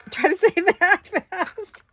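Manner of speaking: giggling helplessly through the words, pitch way up; can't stop laughing, squeaky and breathless; laughing fit. Real, not acted. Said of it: "now I have tears in my eyes".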